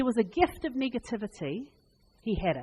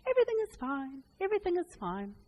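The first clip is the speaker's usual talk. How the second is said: higher, sing-song fake cheer; Pollyanna pretending; 7 semitones higher than usual. Pollyanna insists all is fine with higher fake cheer. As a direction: paste on a cheerful denial. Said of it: "she just pretended all the time".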